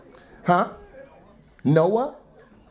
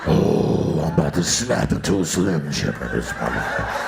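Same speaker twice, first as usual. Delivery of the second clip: lower, raspy wrestler growl; Macho Man voice; 11 semitones lower than usual. Pretend. Comic imitating Randy Savage to the woman. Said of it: "You sound like Macho Man".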